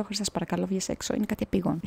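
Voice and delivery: quiet pleading at night; hushed plea. Retold or imitated. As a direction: plead under your breath so nobody wakes. Begging friend to come out.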